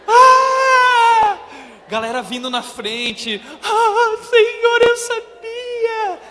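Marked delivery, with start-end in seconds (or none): 0.1-1.4 very high, very loud ecstatic shriek; crowd ecstatic scream. Retold or imitated. Youth crowd climbing speakers screaming in ecstasy. 3.6-6.2 very high and loud, slow rapturous cry; ecstatic cry. Retold or imitated. Youth rushing forward shouting to the Lord, high and loud.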